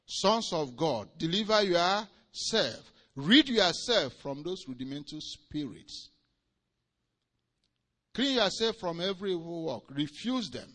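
loud, rising preacher's cry, stretched on erase; preaching at full force. Acted. Preacher urging the congregation, preaching at full force. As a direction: thunder it from the pulpit.

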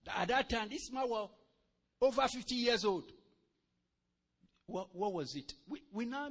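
ordinary talk

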